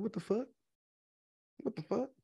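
very soft, baffled aside; hushed disbelief. Retold or imitated. Commentator retelling her reaction to the vlog father claim, hushed disbelief. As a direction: say it under the breath, questioning.

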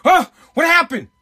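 loud, high mock gasp of onlookers; startled reporters shouting; shocked crowd. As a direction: yelp it like a stunned crowd. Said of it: "people go".

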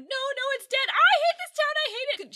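high, loud distraught outburst; heading into hysterics. Retold or imitated. Retelling Connie finding the phone dead, near hysterics. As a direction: let it tip into hysterics. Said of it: "She's heading into hysterics".

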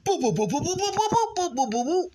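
high-pitched rapid boops; playful high punch noises; imitated punch flurry. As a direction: mimic a volley of quick jabs.

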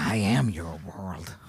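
low, slow creature voice; deep, drawn-out mock menace; menacing monster. Voicing the monster's menacing claim.

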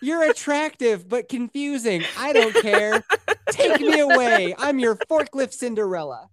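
mock a starstruck girl being carried off; swooning damsel. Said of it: "And you were just like".

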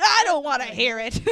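mildly hammed-up captain cutting someone off; mock angry captain. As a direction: imitate a fed-up boss, half laughing. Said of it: "the typical angry captain shtick".

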